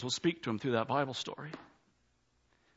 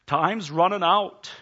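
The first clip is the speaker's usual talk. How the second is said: louder, slow, pressing; urgent warning; 5 semitones higher, 7 dB louder, slower than usual. Acted. Preacher warning the congregation, slow and louder. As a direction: lean into "time's running out" slowly and louder. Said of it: "preacher building urgency".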